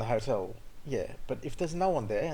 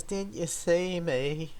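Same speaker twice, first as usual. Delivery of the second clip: slow, higher, mock-drunk drawl of Didn't you see me; drunk driver; 6 semitones higher, much slower than usual. Drunk woman asking after the crash, slow mock-drunk. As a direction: play the tipsy woman, dragging it out. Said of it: "She goes".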